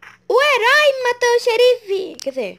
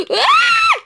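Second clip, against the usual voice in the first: excited scream; 12 semitones higher, slower than usual. Real, not acted. Finds the murderer.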